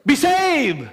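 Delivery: sudden high shout of be saved; hammed-up street preacher yell; mock shouted command. Mocking a one-line gospel pitch.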